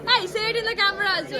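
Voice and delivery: high, loud, fast urging; bubbly coaxing to camera; excited. Real, not acted. Urging the child loudly.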